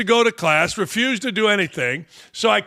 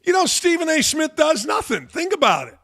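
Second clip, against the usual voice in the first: indignant exclaim; 7 semitones higher than usual. Real, not acted. Ranting about Stephen A. Smith.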